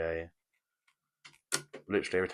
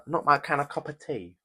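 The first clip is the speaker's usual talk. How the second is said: put-on accent, higher and louder; Spike Lee accent; 8 semitones higher, 7 dB louder than usual. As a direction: toss the line off in a put-on accent. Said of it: "I wish I didn't do that accent".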